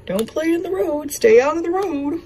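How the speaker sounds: lightly voiced lecture to a little girl; preaching parent. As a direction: parent repeating the road rule to her toddler, mildly stern. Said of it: "we preach to her".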